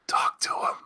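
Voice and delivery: hushed, voiceless whisper. Real, not acted. Host advising guys scared of women, whispered. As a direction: whisper it like plain obvious advice. Exasperated advice to shy guys.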